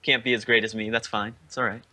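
normal talking voice

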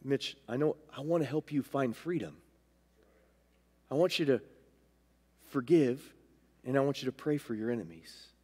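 low, soft voice of God, with pauses; the Lord gently guiding. Retold or imitated. God wants to help Mitch find freedom, low gentle coaxing. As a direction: guide quietly, phrase by phrase. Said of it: "Just with his staff".

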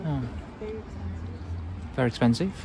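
usual speaking voice

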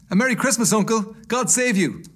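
high, loud festive greeting; bright-spirited, hearty hail to an uncle; cheerful nephew. A narrator's reading. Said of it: "cried a cheerful voice".

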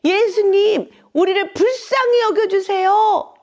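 high, loud cry for mercy; loud pleading call. Acted. Blind men begging Jesus for mercy, loud high call. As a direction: call out to be heard over the crowd. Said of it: "큰 소리로 예수님을 부른 거죠".

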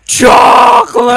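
high, loud and slow shout; excited shout. Real, not acted. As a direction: yell the word Chocolate with glee. Shouted interjection.

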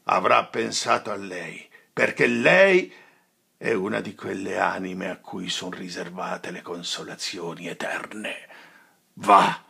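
bitter sorrowful dismissal; grieving reproach. Acted. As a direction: reproach him through grief. Friar's grief and reproach.

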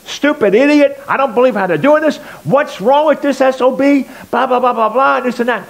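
high, loud mock rant at the TV; imitating angry yelling. Retelling his dad cursing the TV, loud mock yelling. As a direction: rant at the news anchor like a furious old man. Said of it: "yelling at him, calling him names".

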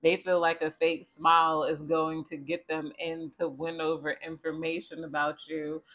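ordinary delivery

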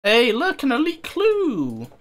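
high, drawn-out delighted call; excited discovery. Real, not acted. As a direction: sing out the find, pitch soaring. Calls out a find.

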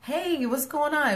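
high, bright mock hello; lightly voiced stranger's greeting; imitating a polite hello. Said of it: "didn't even get, like, a word".

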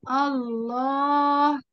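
الله stretched very slowly, long aa; drawn-out demo word. Shown as a demonstration. Teacher listing words with a hidden alif, very slow. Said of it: "إيه كمان؟".

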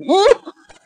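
short high laugh; burst of laughter. Real, not acted. Vlogger cracking up at the clip, high laugh. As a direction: let a real laugh burst out.

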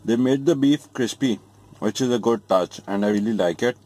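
chewing while talking; eating a burger; mouth partly full. Real, not acted. Seen 0: Holds burger after bite; lips pursed, jaw working between words.

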